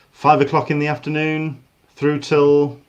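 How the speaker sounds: ordinary delivery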